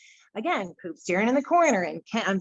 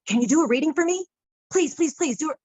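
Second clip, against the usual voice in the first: high, louder retold begging; pitched-up, insistent mock plea; imitated eager pleading; 6 semitones higher, 4 dB louder than usual. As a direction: act out someone begging for a favor. Retold bartender begging for a reading.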